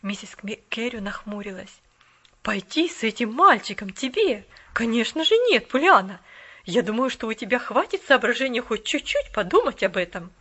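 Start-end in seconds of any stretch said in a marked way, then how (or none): 2.4-10.3 loud, firm lady; stern refusal, louder. A narrator's reading. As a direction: mistress scolding the girl.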